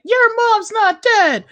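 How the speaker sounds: mock-innocent high whine. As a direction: drag it out in a squeaky taunt. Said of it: "he's like".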